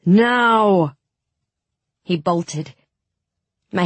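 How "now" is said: loud, raised, drawn-out shout; louder command. A narrator's reading. Tolia repeats his order over the mob, shouting louder. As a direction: call the order out at full voice. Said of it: "then louder".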